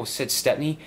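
ordinary delivery, nothing marked

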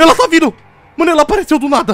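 high loud frightened yell; panicked yelling. Real, not acted. Monster chasing them.

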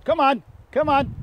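high, loud, quick call; calling the dog. Real, not acted. Trainer urging his dog to come, high loud call.

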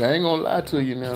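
usual speaking voice

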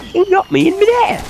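very high squeaky complaint; squeaky high whine. Acted. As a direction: squeal it like a cartoon.

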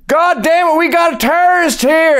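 high-pitched mock alarm; panicked teacher. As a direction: caricature a hysterical teacher sounding the alarm. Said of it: "the teacher just went".

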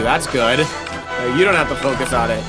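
ordinary talk, nothing marked

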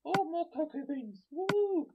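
loud, high whoop of delight; excited cheer. Real, not acted. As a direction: celebrate the find, rising into a woo. Cheers a chest find in game.

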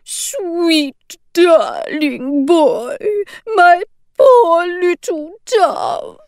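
high, slow, tearful; choked up. A narrator's reading. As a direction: choke the words through tears. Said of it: "choked out Mary".

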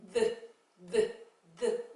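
드 said slowly as a voiced th; voiced th demo. Shown as a demonstration. Teacher modelling the voiced th for pupils, slow. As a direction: drag out 드 with the tongue between the teeth. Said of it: "티에이치 소리는 두 가지예요".

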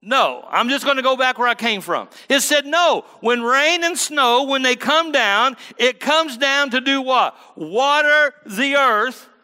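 high, loud, slow hammering sermon climax; preaching at full force. Acted. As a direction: drive the point home to the congregation.

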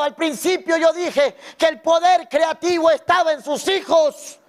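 preaching at full force to the congregation; full-voiced sermon, every phrase pushed out; fiery preaching. (predicando a toda voz ante la iglesia)

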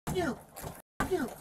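dropping from a shout to a near whisper; fading hissed curse. Real, not acted. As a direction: spit it out under your breath. Arrestee muttering curses at officer.

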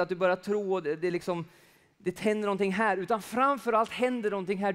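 preaching. Real, not acted. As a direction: press the point home to the congregation.

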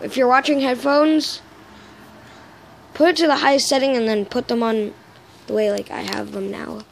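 normal talking voice

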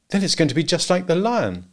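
lightly voiced wife looking forward to trouble; anticipation. A narrator's reading. Wife expects a repeat of the lion, mild anticipation. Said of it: "full of anticipation".

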